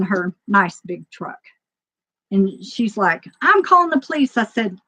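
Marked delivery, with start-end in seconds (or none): 3.4-4.4 higher-pitched mimicked threat; retold threat. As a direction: imitate an opponent threatening to call police. Retold threat from the other woman.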